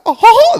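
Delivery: high, loud cartoon chuckle; Mickey Mouse laugh. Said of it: "I got the laugh".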